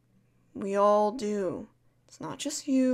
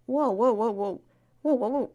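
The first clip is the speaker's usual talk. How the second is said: louder, alarmed whoa; startled whoa; 5 dB louder than usual. Pretend. Alarmed as a crying friend starts to panic. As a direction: react fast to their panic.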